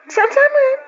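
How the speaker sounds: high, loud, startled cry of where; shocked, calling out where; loud high disbelief; surprised shout. Acted. Friend reacts at the bar.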